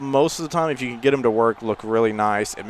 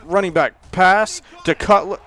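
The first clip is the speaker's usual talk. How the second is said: high, loud, urgent shout; alarmed play call; 8 semitones higher, 6 dB louder than usual. Real, not acted. Gamer spots a pass to Cutler, alarmed. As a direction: call the trick play as it happens.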